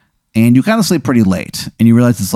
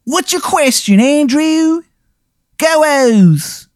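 high-pitched, hammed-up Baltimore accent; cartoonishly high Baltimore accent; terrible Baltimore accent; much slower than usual. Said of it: "his terrible accent".

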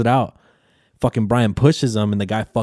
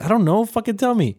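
much higher-pitched, impatient retelling; mock exasperation; 11 semitones higher than usual. Friend tired of being made to guess, mock exasperation. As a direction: give up guessing and demand the answer. Acted reply to guessing game.

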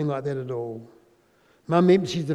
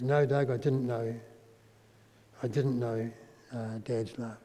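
quiet, lightly tearful retelling of mum's words; a hint of a crying mother, voice dropping away softer; 4 semitones lower, 6 dB quieter than usual. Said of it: "Mum starts to cry".

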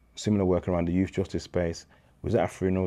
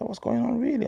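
astonished friend. Retold or imitated. Friend's astonished reply retold.

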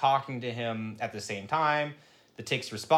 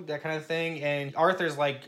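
usual speaking voice

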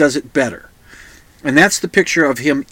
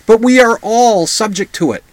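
usual speaking voice

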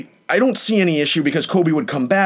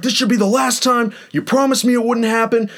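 higher pitched mock angry neighbor; imitating an irritated gym guy, raised pitch; 8 semitones higher than usual. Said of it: "He's like".

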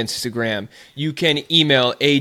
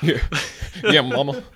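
laughing. Real, not acted. Laughing, can't get going.

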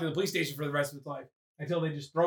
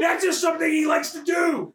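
high, emphatic protest; mock defensive; 9 semitones higher than usual. Narrator defending Howard's tree habit, high indignant protest. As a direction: insist over the laughter in mock indignation.

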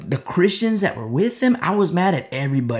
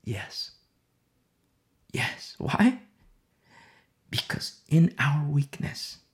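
ordinary talk

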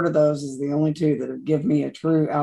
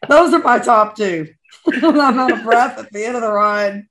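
laughing through the words, louder and higher; real laughter on the line; 6 semitones higher, 6 dB louder than usual. Real, not acted. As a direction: giggle while telling it. Laughing recounting favorite coasters.

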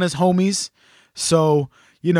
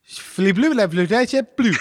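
mock French accent; 4 semitones higher than usual. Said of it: "as it says at Disneyland Paris".

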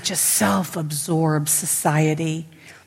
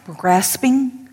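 ordinary delivery

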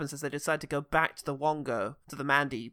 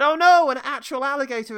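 much higher, louder oh no; dramatic gasp of alarm; mock alarm; 8 semitones higher, 9 dB louder than usual.

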